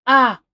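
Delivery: sudden loud pained "Ah"; sharp cry of real pain; startled loud yelp after biting wrong. Real, not acted. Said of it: "Also, ow".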